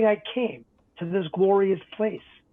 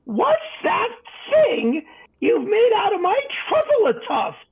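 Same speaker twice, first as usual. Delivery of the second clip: much higher, louder, huffing creature voice; shouting, puffing Lorax; 13 semitones higher, 4 dB louder than usual. Acted. The Lorax confronting the Onceler, shouting and puffing. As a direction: puff up and shout like an outraged little guardian. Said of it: "as he shouted and puffed".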